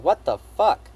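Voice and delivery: loud, stunned blurt; shocked outburst. Retold or imitated. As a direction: recoil in loud disbelief. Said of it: "And I'm like".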